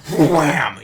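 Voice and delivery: a sudden loud punch noise; loud wham sound effect. Retold or imitated. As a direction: smack the word out like a hit. Narrating a punch.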